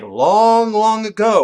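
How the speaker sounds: loud, drawn-out fairy-tale opener; storyteller opening. Shown as a demonstration. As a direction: boom it out like the start of a legend. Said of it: "start with something like".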